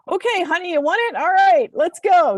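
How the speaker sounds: high, loud "here we go" voice; gung-ho mock agreement. Woman acting out pushing herself to say yes, gung-ho. Acting out her forced willingness.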